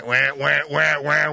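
mock animal wailing; imitated animal wah. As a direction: copy the creature's call.

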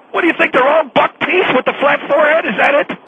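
usual speaking voice

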